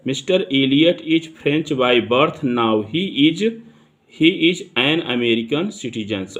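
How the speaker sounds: slow, deliberate example sentence read; dictation reading. Shown as a demonstration. Teacher reading an example sentence to students, slow and deliberate. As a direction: read it out slowly, word by word for the class.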